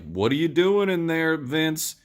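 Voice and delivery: mock yell to the cell; calling out. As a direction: shout it into the next room. Don Johnson calling out.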